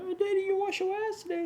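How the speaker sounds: put-on high little-kid voice; mock child voice, playful; imitating his son. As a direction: squeak it like a small boy teasing dad. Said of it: "he probably gonna say something".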